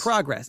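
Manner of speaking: PROGRESS with stress on the first syllable; noun pronunciation. Shown as a demonstration. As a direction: say Progress as the noun, PROG-ress. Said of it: "if it's used as a noun".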